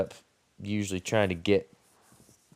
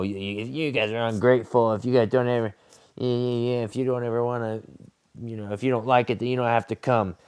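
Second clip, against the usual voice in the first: a hint of a livid boss, rushed and a little higher; mock angry owner; 3 semitones higher, faster than usual. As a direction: imitate the owner scolding, fast and put-on. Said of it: "one of the owners was just livid".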